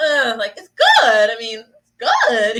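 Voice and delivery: GOOD drawn out slowly, reluctant; groan, then stretch the word good with doubt; extra emphasis on good. Acted. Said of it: "you put a little, little e-, extra emphasis".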